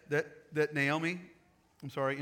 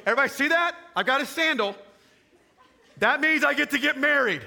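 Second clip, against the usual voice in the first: high, loud, triumphant call; triumphant announcement; 10 semitones higher, 10 dB louder than usual. Retold or imitated. Boaz showing off the sandal to witnesses, triumphant and loud. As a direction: announce it to everyone, gleeful.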